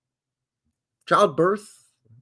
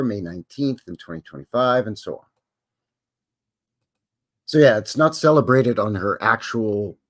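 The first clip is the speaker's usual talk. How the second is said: usual speaking voice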